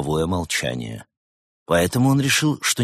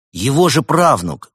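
higher, louder, startled completion; bewildered; 8 semitones higher, 7 dB louder than usual. A narrator's reading. Артём finishing her sentence, just as bewildered. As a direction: blurt it out in confusion. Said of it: "не менее растерянный Артём".

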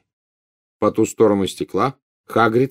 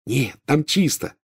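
high, drawn-out happy report; joyful; 5 semitones higher, slower than usual. A narrator's reading. Ron tells friends the door is clear, joyful. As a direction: announce the good news, slow and pleased. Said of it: "радостно сообщил Рон".

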